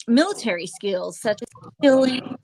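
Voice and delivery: ordinary talk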